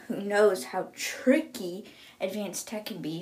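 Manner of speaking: ordinary delivery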